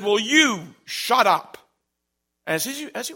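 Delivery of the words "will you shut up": higher, louder, slow furious shout. Retold or imitated. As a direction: bark it at the priest in rage. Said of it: "The presenter was furious".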